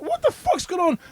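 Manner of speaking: squeaky bewildered cry; panicked high voice; high baffled yelp. Retold or imitated. Retold bewilderment.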